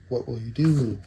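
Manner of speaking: ordinary talk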